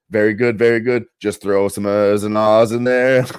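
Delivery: loud, slow hammed-up Boston drawl; Kennedy clone voice. Telling his friend the cartoon scene, loud mock Boston drawl. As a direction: play the clone coaching his accent. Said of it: "and he's like".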